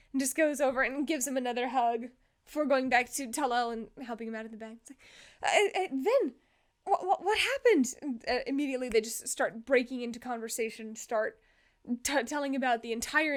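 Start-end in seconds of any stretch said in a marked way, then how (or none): 5.4-7.9 stammered, higher-pitched question; acted anxious bewilderment; flustered concern. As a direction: trip over the words in worry. Jin anxiously asks Vin.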